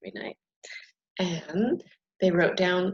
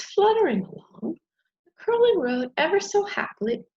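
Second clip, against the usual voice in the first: high and light; 11 semitones higher, 4 dB louder than usual. A narrator's reading. Butterfly fluttering happily.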